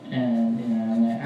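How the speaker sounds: ordinary delivery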